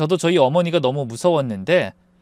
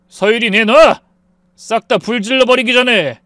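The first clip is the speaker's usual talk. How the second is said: loud, forceful demand; angry threat; 5 semitones higher, 7 dB louder than usual. A narrator's reading. Husband demanding the child from ex-wife, angry threat. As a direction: bark the demand, then threaten.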